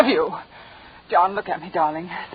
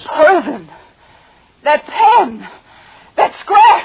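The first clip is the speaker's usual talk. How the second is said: horrified shock; 8 semitones higher, 6 dB louder, much slower than usual. Acted. Wife realizes the poison.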